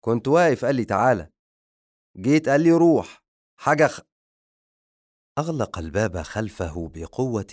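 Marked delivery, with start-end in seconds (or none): 0.0-4.0 loud, fast exasperated complaint, rising higher; baffled grumble. Acted. The servant walking out muttering about contradictory orders, exasperated. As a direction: grumble in disbelief as you leave. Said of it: "في تعجب ويقول".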